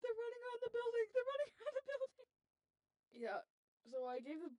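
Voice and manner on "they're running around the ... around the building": fast, high, loud mock panic; frantic report. Retelling report to the nine one one operator, frantic. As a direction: gabble the alarm twice, reliving the panic.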